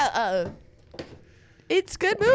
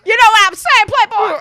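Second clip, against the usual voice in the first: loud tough-guy swagger; 9 semitones higher, 10 dB louder than usual. Pretend. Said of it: "That was the greatest voice I've ever heard".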